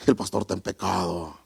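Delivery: low, hushed eerie voice; mock supernatural voice. Preacher mocks voices people want to hear, deep eerie voice. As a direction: intone it deep and soft like a spooky voice. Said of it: "nosotros queremos escuchar voces".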